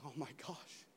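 a woman leaving the clinic in soft, hushed despair. Retold or imitated. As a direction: breathe out the bad news quietly. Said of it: "a woman was leaving a medical clinic going".

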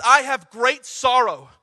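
slow, weighty scripture read; grave reading of Paul's lament; slow solemn reading. Shown as a demonstration. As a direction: let each word sink in. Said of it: "He says".

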